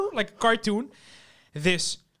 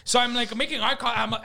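normal talking voice

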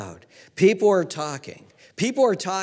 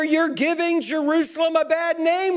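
high-pitched outraged crowd member; acted loud indignation; indignant scolding; 10 semitones higher, 4 dB louder than usual. Scolding crowd voiced by preacher.

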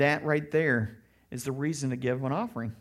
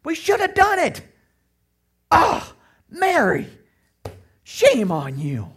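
loud, drawn-out mock outrage; hammed-up scolding at full volume, mostly high-pitched; righteous indignation; 9 semitones higher, 10 dB louder than usual. Judas shaming Mary over the poor, hammed-up righteous indignation. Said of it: "his righteous indignation is like".

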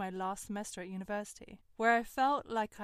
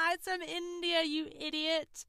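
higher, laughing mockery of the boyfriend; mock-laughing insult, higher and louder; 8 semitones higher, 6 dB louder than usual. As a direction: sneer it through a laugh.

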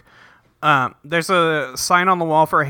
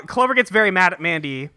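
ordinary talk

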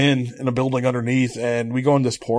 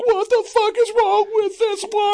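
much higher, appalled; high incredulous cry; appalled outburst. Retold or imitated. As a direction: throw it up high in disbelief. Exasperated rhetorical reaction.

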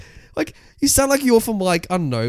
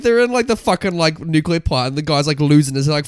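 usual speaking voice

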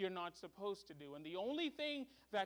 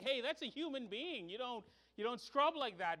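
higher, louder indignant call through glass; mock protest; 6 semitones higher, 4 dB louder than usual. Father through window to nurse, mock indignant protest. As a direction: playfully scold the nurse. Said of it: "I almost tapped on the window like".

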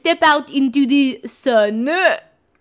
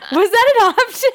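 higher, louder, half-laughing disbelief; mock shock; 6 semitones higher, 4 dB louder than usual. Real, not acted. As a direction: play it scandalised and delighted. Mock outrage at naked Edward.

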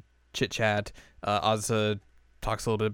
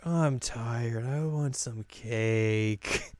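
tired whine; 5 dB quieter, much slower than usual. Retold or imitated. Yui whining for cake.

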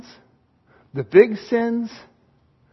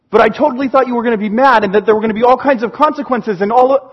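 fast, breathless run-on blurting; anxious kid; much faster than usual. Acted. Kid expecting parents to be mad, anxious. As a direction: rush it out until cut off.

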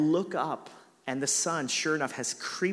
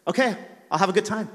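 grudging sarcasm; 6 semitones higher, 7 dB louder, faster than usual. Retold or imitated. Forced agreement in story.

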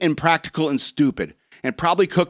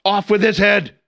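louder, higher royal command; evil queen; 4 semitones higher, 4 dB louder than usual. Pretend. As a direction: bark the order like a tyrant queen. Queen orders an execution.

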